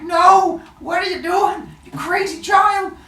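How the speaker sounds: high frantic yell at a runner; frantic coach. Retold or imitated. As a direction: shout like a panicked coach stealing third. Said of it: "you're like".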